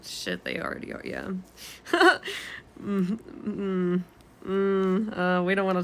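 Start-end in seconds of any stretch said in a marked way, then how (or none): 0.0-1.3 whispered aside. Real, not acted. Muttered aside about the war. 1.3-2.8 awkward laugh. Real, not acted. Laughs off war talk.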